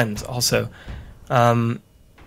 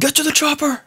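a much higher, hammed-up action-movie shout; Arnold impression; 12 semitones higher than usual. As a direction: bark it like a cheesy action hero, half-joking. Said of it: "I can't do a Arnold impression".

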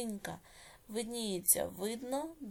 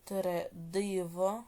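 slow, drawn-out syllables with rising ends; dictation; much slower than usual. Shown as a demonstration. Teacher dictating exercise eight to pupils, slow measured dictation. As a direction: dictate each word slowly, leaving long writing pauses.

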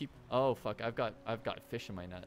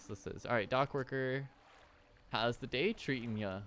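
ordinary delivery

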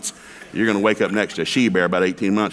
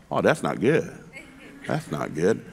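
worried thought; 5 semitones lower, 5 dB quieter than usual. Retold or imitated. Inner thought, dropping quieter.

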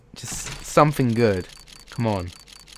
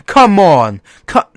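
frustrated shout; 7 semitones higher, 12 dB louder, slower than usual. Real, not acted. Opening a case, shouting Come on! at the game. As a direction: Come on! yelled loud and higher. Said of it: "Ah, fuck's sake".

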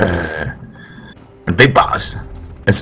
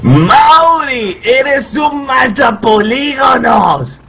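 playful outraged shout; 9 semitones higher, slower than usual. Real, not acted. Teasing friend for killing Meryl.

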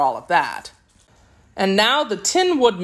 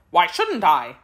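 ordinary delivery, nothing marked

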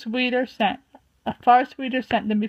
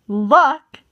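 sharp bristling "Luck?"; 3 semitones higher, 6 dB louder than usual. A narrator's reading. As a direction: snap the word back in offense. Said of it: "Tall Paw bristled".

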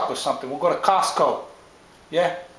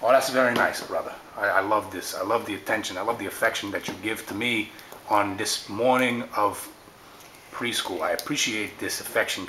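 tender sweet-talking brother; 8 semitones lower, 5 dB quieter than usual. Acted.

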